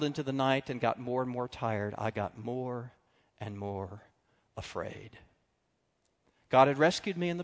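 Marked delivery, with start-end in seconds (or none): 2.0-5.2 fading to quiet. Real, not acted. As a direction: drop softer and lower word by word. Fading into fear.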